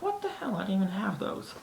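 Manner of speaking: very high, outraged disbelief; indignant protest. Retold or imitated. Blamed on Twitter for the cereal, high indignant protest. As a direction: protest the blame, pitch shooting up. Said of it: "I'm like".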